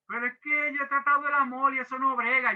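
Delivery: a hint of a frustrated church member; complaining congregant. Church member telling the pastor love doesn't work, mildly plaintive.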